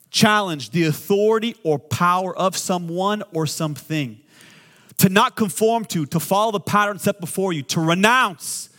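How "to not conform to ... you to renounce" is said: preaching at full force. Acted. Pastor rallying the congregation against fear, preaching at full force. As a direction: build it like a pulpit climax.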